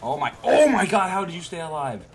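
high, loud outburst of disbelief; exasperated disbelief. Real, not acted. Exclaims at enemy surviving.

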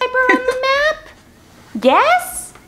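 high-pitched kids' cartoon host; high cartoon explorer prompt; Dora. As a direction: pitch it up, asking young viewers. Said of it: "driven crazy by Dora".

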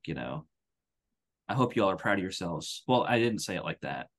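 normal talking voice

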